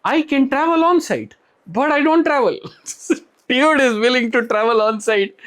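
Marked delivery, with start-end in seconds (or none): 0.0-2.6 mock candidate quote. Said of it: "He goes". 2.6-3.2 short real laugh. Real, not acted. Laughs at candidate's answer.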